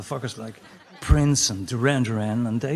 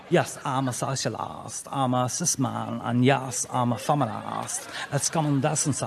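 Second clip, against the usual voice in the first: mock socialist accent with stretched open vowels; affected, drawling parody of a socialist accent. Socialist declaring his identity and decency, mock socialist accent. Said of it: "Socialist accent".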